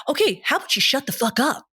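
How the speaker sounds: ordinary talk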